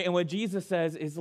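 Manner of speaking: ordinary talk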